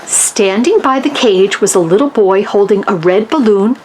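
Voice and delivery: usual speaking voice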